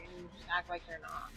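ordinary delivery

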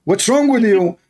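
ordinary talk

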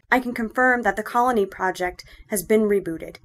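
usual speaking voice

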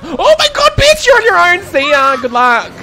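high, loud gasping shriek while fleeing; panicked shriek. Real, not acted. Player shrieking goodbye to a teammate left behind. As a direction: bail out screaming, half laughing. Abandoning teammate as killer appears.

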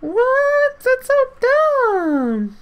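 high squeal. Real, not acted. Shocked high squeal at friend.

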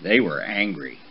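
ordinary delivery

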